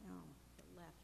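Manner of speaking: ordinary talk, nothing marked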